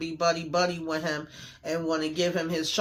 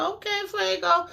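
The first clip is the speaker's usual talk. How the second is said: playful fan approval, pitched way up; high teasing cheer; 14 semitones higher than usual. Real, not acted. Fan cheering Fuego's loyalty, high and playful. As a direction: squeal the name up high.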